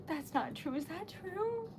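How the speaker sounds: ordinary talk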